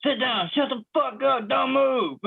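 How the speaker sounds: high, barked holdup command; robber's order. Retold or imitated. Friend acting out a robbery at home, mock yell. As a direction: play a panicky robber ordering hostages.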